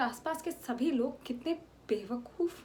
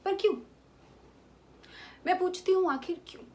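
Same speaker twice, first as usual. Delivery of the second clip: higher, louder challenge to the court; defiant outcry; 7 semitones higher, 4 dB louder, faster than usual. Acted. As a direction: fling the question at the judges. Defiant plea before the court.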